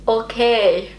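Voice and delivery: "Okay" in an attempted German accent; German-style okay. Shown as a demonstration. English speaker trying to pass as German, German accent. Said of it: "I wanna sound more German".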